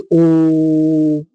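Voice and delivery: teacher demonstrating a prolonged ओ to the class; long drawn-out vowel. Shown as a demonstration. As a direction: stretch ओ for three beats. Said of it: "तीन मात्रा का समय लगता है".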